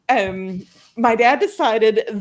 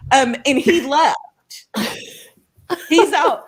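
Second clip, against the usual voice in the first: ordinary talk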